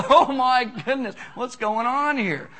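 high, loud mock panic; terrified kids. Preacher voicing frightened kids, mock panic. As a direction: squeal like a scared five-year-old.

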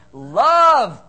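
Love belted out loud and high; shouted word. Real, not acted. Pastor calling out the answer to husbands, loud shout.

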